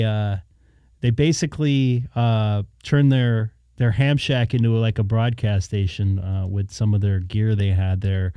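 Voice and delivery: ordinary delivery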